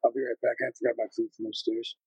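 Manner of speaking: normal talking voice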